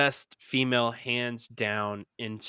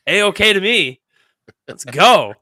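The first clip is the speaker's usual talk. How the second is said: excited; 7 semitones higher, 10 dB louder, slower than usual. Real, not acted. Hyped about heel beating.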